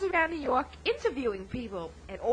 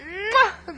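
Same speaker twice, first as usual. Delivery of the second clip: big, higher-pitched kiss noise; blown kiss; 7 semitones higher, 6 dB louder than usual. Acted. Film star saying goodbye to radio listeners, loud blown kiss. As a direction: smack a loud "mwah" kiss. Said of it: "Throw a little kiss".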